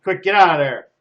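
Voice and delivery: loud, hurried urging; urgent warning. Acted. Guard urges the thief to flee, loud and urgent. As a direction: hiss it out fast and loud, to get them moving.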